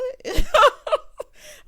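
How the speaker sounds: loud, high laugh; burst of laughter. Real, not acted. Recapper tickled by country boys, loud high laugh. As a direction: crack up loudly and high.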